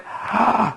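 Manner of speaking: sharp high gasp of shock; whole room gasping at the actress. Retold or imitated. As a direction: gasp like you can't believe your ears.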